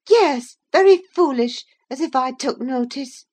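high, peevish retort; petulant and scornful, starting loud. A narrator's reading. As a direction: dismiss him crossly in a high girl's voice. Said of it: "in a peevish tone".

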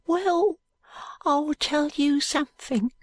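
high, slow urchin confiding. A narrator's reading. Jo confiding in Allan, cautious high voice. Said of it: "says in a low voice".

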